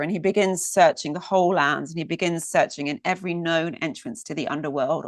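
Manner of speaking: usual speaking voice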